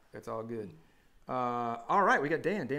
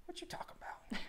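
whisper; 12 dB quieter than usual. Pretend. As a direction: repeat it in a whisper, no voice. Repeats line as a whisper.

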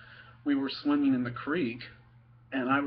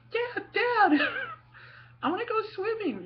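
put-on whiny kid voice. Eleven-year-old son begging dad to swim, high whiny kid voice. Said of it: "And so I was like".

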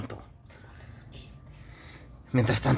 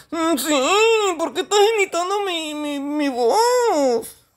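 very high, whiny comic voice; high-pitched mock-tearful character; Toñito; slower than usual. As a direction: whine the complaint, dragging out the end. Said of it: "de repente viene Toñito".

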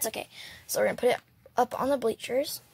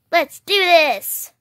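slow loud rallying cry; 5 semitones higher, 8 dB louder, slower than usual. Pretend. Doll rallying before match.

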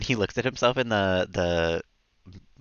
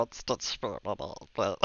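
Stitch voice; 5 dB quieter, much slower than usual. Pretend. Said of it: "talk in the Stitch voice".

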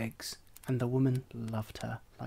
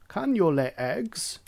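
slow, higher hen challenge; haughty hen; 5 semitones higher, 4 dB louder than usual. A narrator's reading. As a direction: quiz the duckling from above. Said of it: "she asked".

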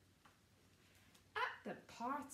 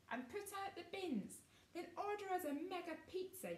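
bossy yell; 9 semitones higher, faster than usual. Acted. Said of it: "yelled Gus".

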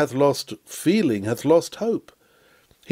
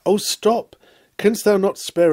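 a high cry pleading him to stop; urgent plea; 7 semitones higher than usual. Acted. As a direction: cry out to hold him back. Pleading with Felix a third time.

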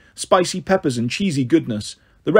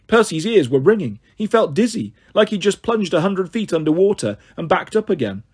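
ordinary delivery, nothing marked

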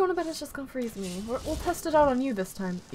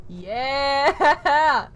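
loud, drawn-out yeah breaking into laughter; excited cheer; 6 dB louder than usual. Real, not acted. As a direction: cheer long and loud, thrilled. Celebrates the staff's effect.

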